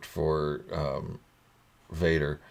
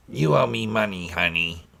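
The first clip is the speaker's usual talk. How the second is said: lightly raised waitress imitation; a hint of a weary fifties waitress; diner waitress voice; 4 semitones higher than usual. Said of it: "sound like a nineteen fifties diner waitress".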